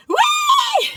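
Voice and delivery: very high, loud gleeful squeal; inner whee. Retold or imitated. Guest squealing inside at a chocolate cake, gleeful. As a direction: let the inner child squeal. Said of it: "But inside I was going".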